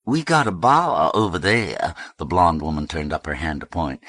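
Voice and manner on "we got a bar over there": raised, sing-song South Alabama voice; South Alabama accent. A narrator's reading. Blonde woman pointing fellas to the bar, South Alabama accent. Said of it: "identify as South Alabama Flats".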